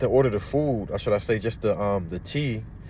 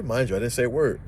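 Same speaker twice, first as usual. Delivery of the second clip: ordinary talk, nothing marked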